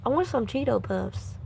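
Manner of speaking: usual speaking voice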